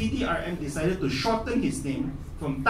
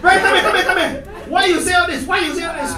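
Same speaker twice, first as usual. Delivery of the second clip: stern teacher; 10 semitones higher, 10 dB louder than usual. Retold or imitated. Teacher summons boy.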